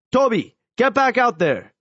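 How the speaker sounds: a lightly voiced coach calling out; coach shouting. A narrator's reading. Coach calls player back onto the field, mild shout. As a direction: bark it across the field like a coach. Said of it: "Coach Landek shouted to me".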